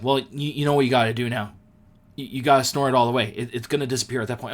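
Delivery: quick, offhand urging; a hint of a friend egging him on; fast friend advice. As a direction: rush through the advice. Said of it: "he's like".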